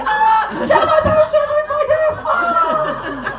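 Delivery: high, loud panicked scream; terrified child screaming as he flies; kid flying off. Retold or imitated. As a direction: wail while flung off the roundabout. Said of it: "flies off going".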